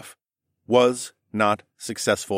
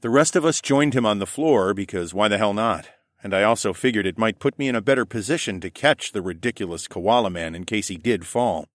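plain reading voice

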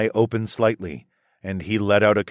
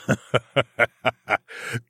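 demon chuckle; 2 semitones higher than usual. Acted. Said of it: "he chuckled".

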